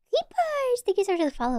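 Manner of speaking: excited thanks. Real, not acted. Thanking a new follower.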